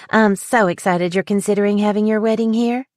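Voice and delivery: a light Southern drawl, bright and eager; a hint of Southern, happy to welcome them. A narrator's reading. Wedding planner greeting the couple, light Southern drawl. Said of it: "she said in her southern drawl".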